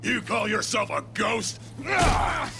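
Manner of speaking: high, loud shout with a scream; furious yell. Acted. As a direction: rage at the prisoner. Villain screams while attacking.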